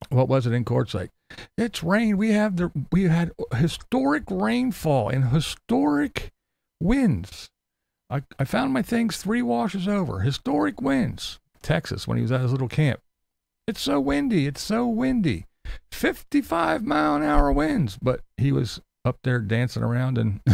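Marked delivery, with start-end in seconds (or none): 1.6-11.4 high mock whine; mocking quote. Vlogger mimicking a YouTuber's weather drama, high and whiny. Quoting Tater's weather claims. 13.7-18.0 high whiny mock complaint; mocking whine. Vlogger mimicking another YouTuber's wind complaints, high and whiny. Quoting Tater in Texas.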